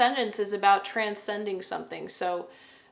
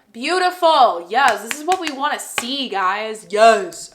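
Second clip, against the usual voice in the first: delighted; 2 semitones higher, 10 dB louder than usual. Real, not acted. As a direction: burst out with real delight. Delighted by a card she drew.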